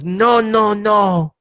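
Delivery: high-pitched refusals from a French tourist; French guy saying no. Acted. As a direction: refuse in a high, lightly put-on voice. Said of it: "And the French guy goes".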